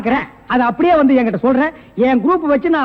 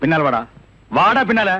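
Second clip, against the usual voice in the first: low menacing order; 9 semitones lower than usual. Acted. Detective confronts the exposed impostor, low and menacing. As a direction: drop the voice low to confront him. Turns on the man he was fooled by.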